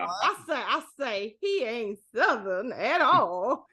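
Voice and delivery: a hint of a blustery Southern cartoon rooster; Foghorn Leghorn. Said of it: "Foghorn Longhorn then".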